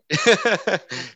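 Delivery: high-pitched burst of laughter; real laugh. Real, not acted. Host amused at baby feeding on podcast, laughing.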